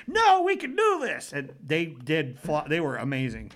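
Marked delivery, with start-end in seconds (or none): 0.1-1.3 put-on high, loud insistent kid voices; determined kids. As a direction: shout it like stubborn children. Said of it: "both Addie and Caleb are like".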